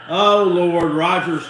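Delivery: loud "Oh, Lord" at a big play; excited outburst. Real, not acted. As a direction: blurt it out loud at the screen. Reacting to a touchdown on TV.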